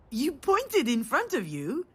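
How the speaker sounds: ordinary delivery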